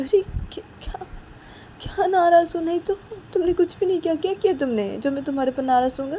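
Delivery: high, loud, fast denial; protesting Sanju. Acted. Sanju denying he is upset with Uma, urgently protesting. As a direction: rush to reassure in startled protest. Sanju denies being angry.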